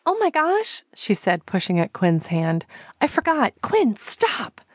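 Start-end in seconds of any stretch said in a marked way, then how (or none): 0.1-0.8 much higher flustered gasp of realization; embarrassed surprise. Acted. Callie realizing Njord can see everything, flustered. 3.0-4.6 higher, half-hearted moaning protest. Acted. Callie moaning at husband Quinn to stop. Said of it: "she moaned".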